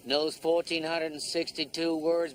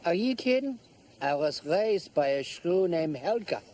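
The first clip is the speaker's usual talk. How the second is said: put-on German accent; 4 dB louder than usual. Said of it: "Can you do a German accent?".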